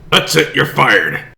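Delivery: loud, sharp dismissal; loud mock-boss outburst; firing him. Boss fires the character.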